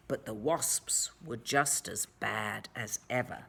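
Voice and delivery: low whispered warning. A narrator's reading. Narrator whispers the bad news to the children. Ominous turn in story.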